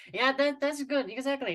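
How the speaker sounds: high, loud burst of praise; excited praise. Real, not acted. Teacher delighted with the student's first try, excited. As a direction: cheer the learner on, delighted.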